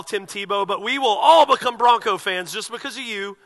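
loud, high, driving preacher voice; fiery preaching. Acted. Pastor rousing his congregation about celebrities, loud and fiery. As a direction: preach it out with full force.

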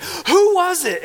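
high, loud, slow demand for a name; outraged demand. Retold or imitated. Jealous boyfriend demanding the lover's name, high slow loud. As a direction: drag out the question in disbelief.

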